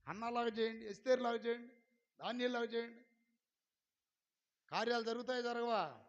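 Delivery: loud, driving exhortation; fiery preaching. Real, not acted. Preacher urging the church to pray with persistence, loudly. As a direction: preach it hard and urgent.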